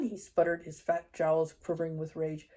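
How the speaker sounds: steady, fuller audiobook reading voice; story narration. A narrator's reading. Reader telling listeners the chapter, audiobook narration. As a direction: read the chapter aloud to listeners. Reads a novel chapter aloud.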